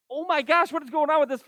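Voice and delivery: high-pitched exasperated outburst. Real, not acted. Teacher to a first row with no answers, exasperated. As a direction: throw up your voice in exasperation.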